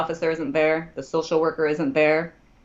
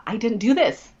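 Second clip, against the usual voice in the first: raised, emphatic denial; desperate protest; 6 semitones higher, 4 dB louder than usual. Retold or imitated. Accused client to lawyer, high protesting denial. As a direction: plead innocence in a high voice.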